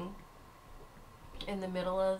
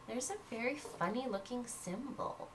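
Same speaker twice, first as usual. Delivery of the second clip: ordinary delivery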